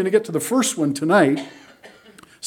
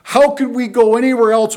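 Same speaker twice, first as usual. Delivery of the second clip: raised, louder, insistent protest; emphatic acted-out objection; 8 semitones higher, 5 dB louder than usual. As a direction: protest as if shocked to be asked. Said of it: "Why are you so emphatic?".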